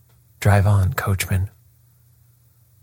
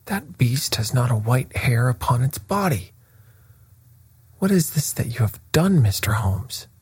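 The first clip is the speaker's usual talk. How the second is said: higher-pitched protest, without extra volume; indignant cry; 4 semitones higher than usual. A narrator's reading. Horse's owner protesting to the detective, mildly crying out. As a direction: raise the pitch in mild outcry. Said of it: "cried the owner".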